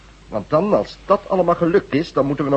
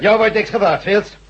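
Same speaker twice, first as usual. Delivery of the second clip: angry retort; 7 semitones higher, slower than usual. Acted. Chief snaps at prisoner.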